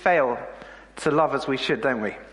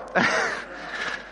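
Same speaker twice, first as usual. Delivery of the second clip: a soft, airy laugh holding back tears; tearful breathy laugh; 3 dB quieter than usual. Real, not acted. Preacher moved by his daughter's words, a tearful airy laugh. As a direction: laugh it off through welling emotion.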